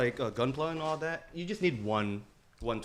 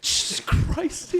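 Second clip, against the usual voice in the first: stunned gasp; 6 dB louder, much slower than usual. Real, not acted. Gift unwrapped, stunned reaction.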